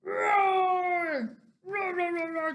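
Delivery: one long loud roar, then quick ones; loud, high storybook battle roars; roaring dinosaur. Acted. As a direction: roar big, then rattle off short roars. Dinosaur attacks the spaghetti.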